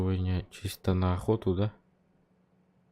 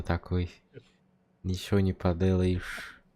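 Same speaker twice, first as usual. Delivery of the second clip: ordinary talk, nothing marked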